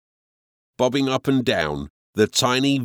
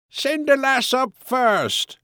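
sailor at the railing; 14 semitones higher than usual. A narrator's reading.

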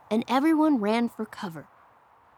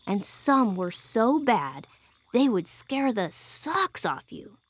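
ordinary delivery

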